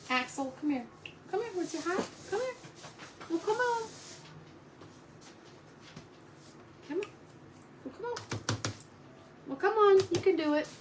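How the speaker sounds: high-pitched calling to a pet; coaxing the dog. Real, not acted. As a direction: sweetly urge a dog to come over.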